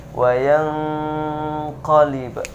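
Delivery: wayang qalib with the ng hummed; correct nasal hold. Shown as a demonstration. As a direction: hum the ng before qalib. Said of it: "Sekali lagi". (wayang qalib dengan dengung)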